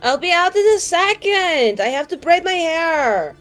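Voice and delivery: high, loud holler to someone outside; calling through the door. Acted. Woman getting ready calls to visitor at door, hollering. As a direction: yell it out, drawn to the end.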